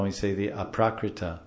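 ordinary talk

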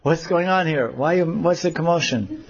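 feigned innocence. Acted. Krishna feigns ignorance.

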